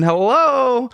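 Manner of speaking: high, drawn-out hello; retold second knock-and-holler; calling through door. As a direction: call out to whoever is behind the door. Knocks again, calls through the door.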